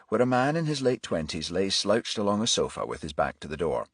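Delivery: ordinary delivery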